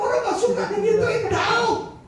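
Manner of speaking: high, laughing rebuke; scolding wife. Retold or imitated. Wife scolding her blunt husband, high and laughing. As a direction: tease him back for his rudeness. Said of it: "Istri saya bilang".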